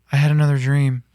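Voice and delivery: mock-solemn announcement; real serious. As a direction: deliver it gravely, imitating someone earnest. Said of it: "he just real serious goes".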